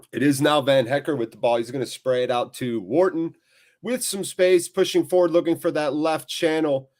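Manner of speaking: commentary rising with the forward move; live play-by-play. Real, not acted. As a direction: track the ball, lifting the pitch.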